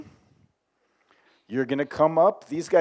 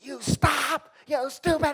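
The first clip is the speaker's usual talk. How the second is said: high-pitched mock scream of rage; imitated angry screaming; 10 semitones higher, slower than usual. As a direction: re-enact losing it on the phone. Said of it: "I started screaming profanity".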